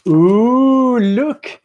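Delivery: gasp, then ooh with rising delight; excited reveal. Real, not acted. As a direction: marvel at the big reveal. Reveals the shoe while unboxing.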